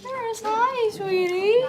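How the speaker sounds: high, slow, loud sweet greeting. Real, not acted. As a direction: coo hello to the pup. Baby talk to the dog.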